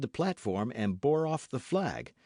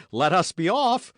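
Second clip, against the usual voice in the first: louder urging; 6 semitones higher, 8 dB louder than usual. Acted. Urging friends to retreat.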